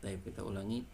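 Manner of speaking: ordinary talk, nothing marked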